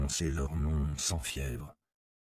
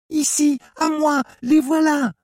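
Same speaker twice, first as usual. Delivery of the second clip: high, loud scream of alarm; woman's yell; 12 dB louder than usual. A narrator's reading. Village woman calling others onto the fugitives, yelling. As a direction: shriek it out to raise the hunt. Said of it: "a hurlé une voix de femme".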